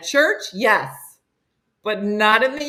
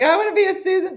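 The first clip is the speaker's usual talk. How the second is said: mock Holly; 12 semitones higher, 4 dB louder, faster than usual. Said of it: "Holly's like".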